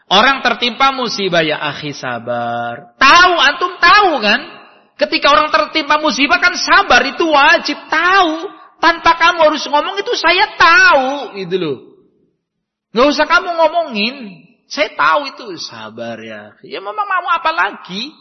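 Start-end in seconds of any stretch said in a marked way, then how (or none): 1.4-2.9 gentle consolation. Acted. Consoling someone hit by misfortune. 2.9-18.1 high, loud mock-irritated retort; irritated sufferer. Afflicted man snapping back at people telling him patience. Annoyed victim rejecting advice.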